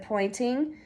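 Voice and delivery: plain reading voice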